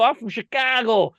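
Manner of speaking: put-on loud, high Chicago accent in retelling; hammed-up Chicago local's accent. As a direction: belt out an exaggerated Chicago accent. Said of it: "go right into a Chicago accent".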